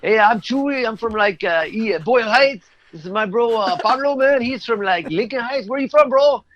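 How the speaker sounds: friendly street bro, pumped at halftime; imitating LA fan bros. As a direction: play the hyped-up soccer fan greeting a stranger. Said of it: "They're like".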